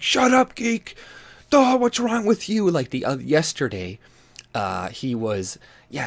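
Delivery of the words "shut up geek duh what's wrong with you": high, loud mocking shout at a cohost; mock angry yell. Host mocking Andy yelling at Geek, high loud mock yell. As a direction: yell it down at him like a bratty boss. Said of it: "blowing up at Geek Thule and being like".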